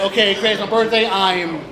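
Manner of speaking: ordinary talk, nothing marked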